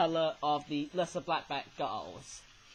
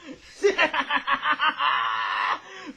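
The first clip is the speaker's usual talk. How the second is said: loud villain cackle, shouted out; a hammy evil laugh bursting out; Snatcher cackle; 8 semitones higher, 6 dB louder than usual. Acted. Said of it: "my terrible expression of the, of the Snatcher".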